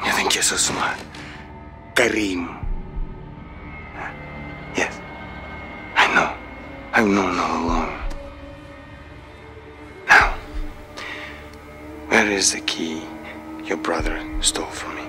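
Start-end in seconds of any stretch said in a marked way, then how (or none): 0.1-1.0 low, hushed taunt; menacing whisper. Acted. Interrogator taunting his captive, menacing whisper. 12.1-15.0 low, hushed questioning; quiet menace. Acted. Interrogator pressing captive about stolen key, quiet menace.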